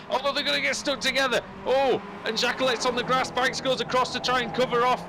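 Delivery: urgent motorsport commentator; excited play-by-play. Real, not acted. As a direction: call the near collision as it unfolds. Live play-by-play.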